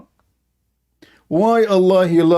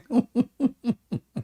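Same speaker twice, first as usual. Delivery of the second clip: laughing; 6 semitones higher, 8 dB quieter than usual. Real, not acted. Mocking laugh after the hadith.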